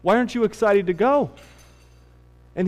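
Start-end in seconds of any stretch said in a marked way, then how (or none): 0.0-1.3 higher, puzzled dad voice; a gently voiced worried father; father asking. Father asks his crying son why, lightly voiced concern. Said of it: "He said".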